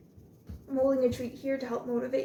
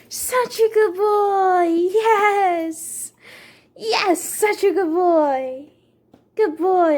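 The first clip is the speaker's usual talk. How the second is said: doggy praise voice; 7 semitones higher, 13 dB louder, much slower than usual. Real, not acted. Owner gushing praise at her dog after a sit, high and drawn out.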